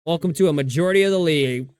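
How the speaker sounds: ordinary talk, nothing marked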